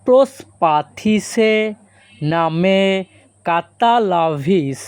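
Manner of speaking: slow, careful pronunciation demo; slow and clear. Shown as a demonstration. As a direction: spell it out slowly for learners. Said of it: "এই বাক্যের Greek ভাষা হবে".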